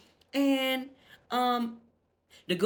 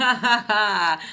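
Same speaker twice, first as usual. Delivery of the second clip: loud ha ha ha laugh; loud real laugh; 3 semitones higher, 7 dB louder, slower than usual. Real, not acted. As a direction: burst out laughing loudly. Laughs at nickname.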